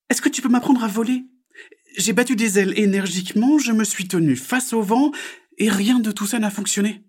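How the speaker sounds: high, loud, insistent frog voice; little frog. Acted. Frog explains failed attempts to magpie, high insistent voice.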